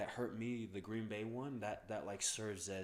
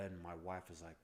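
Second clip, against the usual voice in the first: ordinary talk, nothing marked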